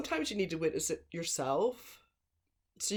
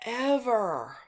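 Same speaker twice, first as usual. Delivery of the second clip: ever punched out higher and louder; stress on ever; 7 semitones higher, 5 dB louder than usual. Real, not acted. Telling viewers about the killer, ever pushed hard. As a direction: lean hard on "ever" as the last word. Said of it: "there was never, okay, ever".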